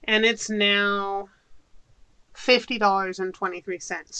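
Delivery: normal talking voice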